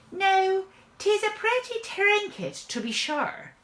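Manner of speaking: high, wondering stage-Irish voice; comic Irishman admiring a find; Irish hero musing. A narrator's reading. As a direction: marvel to yourself in an Irish lilt. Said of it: "he thinks to himself".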